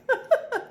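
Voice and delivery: short high giggle; a quick embarrassed chuckle; light high laugh at herself; brief self-conscious laugh. Real, not acted. Laughing at own phrasing.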